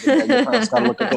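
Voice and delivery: excited. Real, not acted. Excited retelling.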